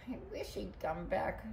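very soft, sad wish; small, forlorn storybook voice; sniffling. A narrator's reading. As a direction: say it softly, missing the dog. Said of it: "sniffled Missus McNosh".

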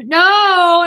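high, loud drawn-out refusal; calling back. Acted. Daughters answer mother from the kitchen, calling back. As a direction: holler back to the next room.

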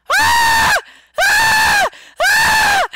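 three piercing high screams; repeated ear-splitting shrieks. Real, not acted. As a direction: scream very high and loud in bursts. Bursts out screaming, then says sorry.

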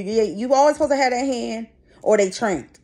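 ordinary talk, nothing marked

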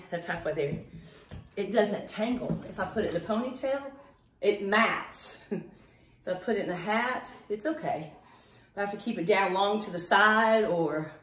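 ordinary talk, nothing marked

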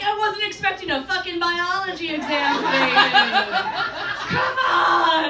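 loud, high protest; exasperated outburst. Retold or imitated. Woman protesting her lover's quizzing, exasperated. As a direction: blow up at him, building to a drawn shout.

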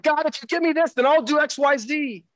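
lightly hammed-up deal with God; bargaining prayer. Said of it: "where I'm like".

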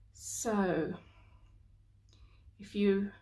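slow, calm meditation voice with long pauses; guided meditation. Acted. Healer relaxing a listener with sage smoke, guided meditation. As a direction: guide the listener gently, phrase by phrase.

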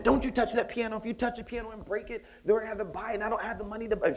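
high-pitched frantic mother scolding; mother snapping at her son over a piano in the mall; scolding mom. Retold or imitated. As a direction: imitate mom going off at her kid. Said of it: "She's just going off".

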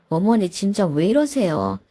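ordinary delivery, nothing marked

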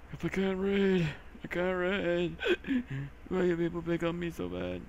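a lightly put-on simpleton voice; mock dim-witted voice. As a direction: play a dopey whiner. Mock self-pitying silly voice.

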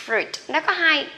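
ordinary talk, nothing marked

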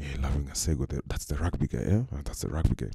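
low, soft crowd murmur; mumbling onlookers. Retold or imitated. Onlookers gossip about the rugby guy, mumbling. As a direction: imitate guys mumbling among themselves, low and hushed. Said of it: "So guys are mumbling".

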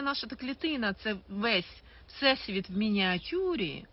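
usual speaking voice

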